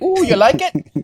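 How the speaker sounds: put-on high, slow eager kid asking; proud child showing off art; little kid voice. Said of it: "like the little kid showing the art to the parents".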